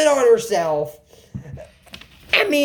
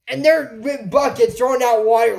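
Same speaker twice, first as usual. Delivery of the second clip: normal talking voice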